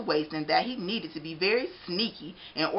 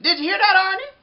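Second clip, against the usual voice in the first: jolly baker voice; 13 semitones higher, 12 dB louder, faster than usual. Acted.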